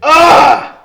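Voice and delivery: loud high ugh of rage; frustrated yell. Real, not acted. Venting about the driver to her co-host, loud frustrated ugh. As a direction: let out a furious ugh. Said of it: "I'm sorry for all the headphone".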